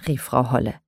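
plain reading voice

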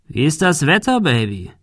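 high, mock-casual question dropping on the last word; sleazy bully's sing-song question; leering taunt. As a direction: taunt the victim with false sweetness. Bully leers at cornered girl.

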